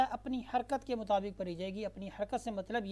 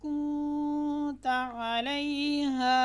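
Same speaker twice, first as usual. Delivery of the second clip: slow melodic chanted recitation, higher and louder; full recitation; 5 semitones higher, 6 dB louder, much slower than usual. Shown as a demonstration. Teacher recites the complete verse again for students. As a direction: recite the whole verse slowly in tones.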